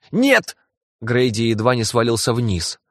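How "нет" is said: very high, sudden cry; alarmed cry. Acted. Grady crying out in alarm.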